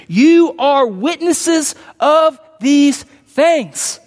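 very slow, weighty reading, each word set apart; slow emphatic reading. Acted. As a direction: lay down the verse slowly and deliberately. Preacher stressing scripture.

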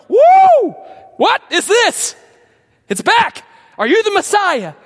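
high-pitched excited whoop into eager questions; amazed crowd. Acted. Crowd greeting a long-awaited prophet, high and astonished. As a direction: act out an astonished crowd, voice leaping up.

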